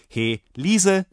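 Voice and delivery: loud, high call to the maid. A narrator's reading. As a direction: summon someone across the inn. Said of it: "rief ich". (lautes Herbeirufen)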